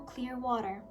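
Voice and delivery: usual speaking voice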